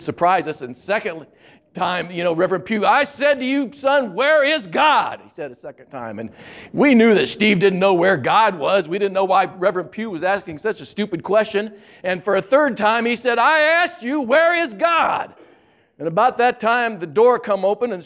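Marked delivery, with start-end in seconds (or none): 2.9-5.2 higher, slow and insistent preacher demanding an answer; booming preacher voice. Retold or imitated. Preacher repeating his question to a silent boy, a stern drawn-out demand. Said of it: "deep, booming voice". 13.4-15.3 much higher, very slow, exasperated preacher; booming preacher voice. Retold or imitated. Preacher asking the boy a third time, exasperated and drawn out. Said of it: "deep, booming voice".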